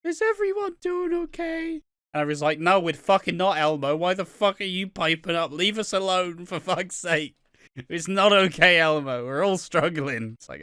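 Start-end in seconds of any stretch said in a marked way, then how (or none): 0.0-1.8 very high puppet voice; squeaky Elmo impression. Quoting Elmo account tweet. 2.6-10.3 louder, higher angry outburst; angry rant. Acted. Angry reply to Elmo.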